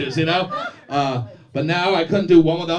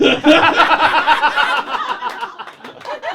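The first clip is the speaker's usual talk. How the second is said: high, loud real laughter; loud burst of laughter; 12 semitones higher, 6 dB louder than usual. Real, not acted. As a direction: crack up at the heckle. Comic laughs at heckler.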